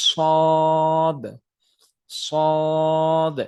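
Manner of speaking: সদ said thick and loud; thick, emphatic সদ; heavy sad. Shown as a demonstration. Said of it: "জিহ্বাটা ছেড়ে দিতে হবে".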